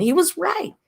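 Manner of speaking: squeaky high agreement; high squeal. Real, not acted.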